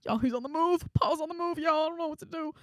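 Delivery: high, squeaky acted panic; freaking out. Student warning friends that Paul's on the move, freaking out. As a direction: pitch way up in mock alarm, straight after a whisper. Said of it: "I was freaking out".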